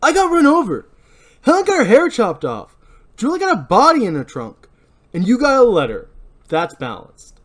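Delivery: high, loud scornful listing; bitter sarcasm. Acted. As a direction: pile up the grievances mockingly. Barry lists their sufferings.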